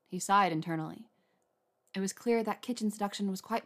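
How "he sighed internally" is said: ordinary delivery, nothing marked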